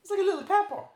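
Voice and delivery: can't get the line out for laughing; laughing through the quote. Real, not acted. Telling his friend about the jalapenos, laughing through it. Laughing while quoting girl.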